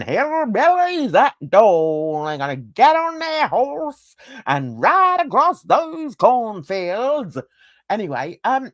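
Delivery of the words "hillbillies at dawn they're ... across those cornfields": loud, drawn-out Southern hillbilly twang; mock hillbilly accent. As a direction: ham up a rolling cowboy-country drawl. Mock hillbilly reading of headline.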